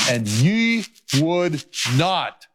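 slowly, weighing ye, would and not; slow lament. Acted. Jesus lamenting over Jerusalem, slow and weighted. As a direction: drag out ye would not with sorrow.

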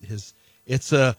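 ordinary delivery

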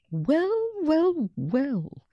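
high, knowing well well well; a teacher pleasantly surprised; amused teacher. A narrator's reading. Teacher reading the essay.

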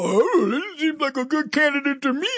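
mock the leftists in a lofty voice. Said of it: "these leftists go".

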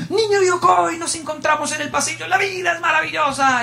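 high, laughing cry of delight; joyful hallway shout. Retold or imitated. Teacher catching the student in the hall, laughing high shout. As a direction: grab the boy and crow it out. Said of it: "encontrarse con él en los pasillos era horroroso".

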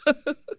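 short high-pitched real laugh; amused laugh. Real, not acted. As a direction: laugh at your own nickname. Laughs at her own nickname.

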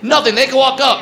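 normal talking voice

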